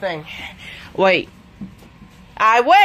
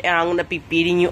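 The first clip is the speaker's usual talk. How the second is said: ordinary talk, nothing marked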